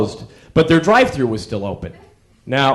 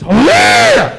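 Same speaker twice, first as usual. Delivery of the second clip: punchline shout; 7 dB louder than usual. Acted.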